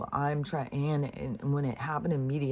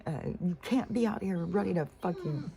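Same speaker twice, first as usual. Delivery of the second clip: higher-pitched retold angry outburst; mock furious "that's it" rant; fuming; 7 semitones higher than usual. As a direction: re-enact scolding the dogs, fed up. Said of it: "I was fuming too".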